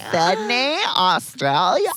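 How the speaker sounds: high, loud Australian echo; hammed-up Australian twang; mock Australian accent. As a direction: echo it in exaggerated Australian, questioning. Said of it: "that was a perfect accent".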